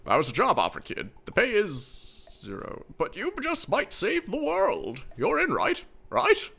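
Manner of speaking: lightly voiced old man, slow and high; a hint of a wheedling old captain. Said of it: "my old man voice".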